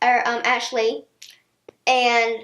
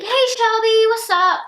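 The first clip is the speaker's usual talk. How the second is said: high, louder chirpy re-enacted hello; perky phone greeting; 9 semitones higher, 4 dB louder than usual. Calling a longtime friend Shelby, perky casual greeting. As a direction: open the call high and breezy.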